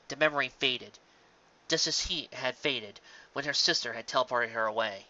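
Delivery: ordinary delivery, nothing marked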